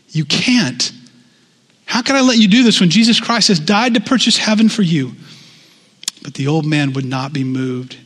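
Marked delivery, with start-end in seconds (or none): 0.1-5.1 higher, louder, urgent plea; pleading. Acted. Missionary pleads with his old friend, urgent raised plea. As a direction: beg him to see the free gift.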